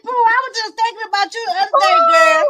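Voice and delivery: high excited hello; excited greeting. Real, not acted. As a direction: call out happily to a friend. Greeting a viewer joining.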